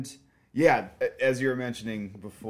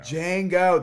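excited exclamation; 8 semitones higher, 8 dB louder than usual. Real, not acted. Repeating title loudly.

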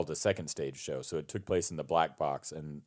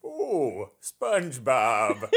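higher, drawn-out cartoon villain voice with a laugh; Plankton; 5 semitones higher, much slower than usual. Shown as a demonstration. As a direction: stretch it out in a put-on cartoon voice. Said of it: "try to do a Plankton voice".

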